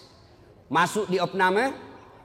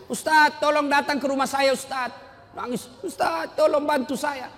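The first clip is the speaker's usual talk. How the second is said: crying widow; 9 semitones higher than usual. Retold or imitated. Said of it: "ada ibu-ibu menangis".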